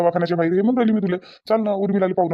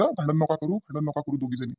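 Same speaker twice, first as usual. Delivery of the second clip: lower, softer peacemaking; hushed plea; 3 semitones lower, 6 dB quieter than usual. Acted. Son-in-law asking two women to stop fighting, hushed. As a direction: let the appeal sink to a quiet, weary close.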